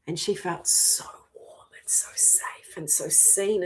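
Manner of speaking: hushed and tender; tender whisper. Real, not acted. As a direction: whisper it like a lullaby. Tender memory of feeling safe.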